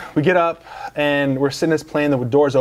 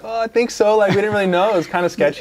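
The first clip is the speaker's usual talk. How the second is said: imitated Panamanian accent, pitched higher; mock Panamanian pilot accent, much higher; 7 semitones higher than usual. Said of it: "in his Panamanian accent".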